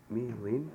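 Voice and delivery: "Mi lin" with both vowels as ee; same E vowels. Shown as a demonstration. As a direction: model "Mi lin" loudly, ee in each syllable. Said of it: "they are pronounced the same way".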